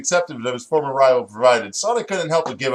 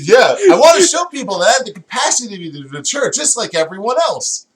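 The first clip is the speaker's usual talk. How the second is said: Sonic voice; 8 semitones higher, 6 dB louder than usual. Acted. Sonic voiced in fanfic reading.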